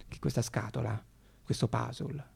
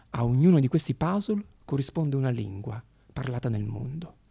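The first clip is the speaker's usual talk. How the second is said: normal talking voice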